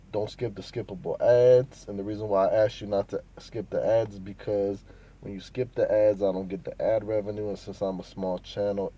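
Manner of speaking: usual speaking voice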